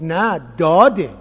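«داده» with a plain d instead of zad, slow; Syrian reply. Retold or imitated. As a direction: answer like the sheikh, «داده» with d. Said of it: "گفت". (لهجهٔ سوری، «داده» با دال، آرام و کشیده)

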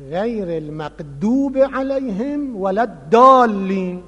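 «الضالین» read as «دالین», d instead of the emphatic z sound, slowly; Syrian dal for zad. Retold or imitated. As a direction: imitate the Syrian sheikh reciting «الضالین» with a plain d, like «دالین». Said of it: "این صاف در قرائت نماز میخون". (تلفظ سوری، «الضالین» با دال مثل «دالین»)

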